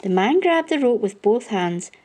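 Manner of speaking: plain reading voice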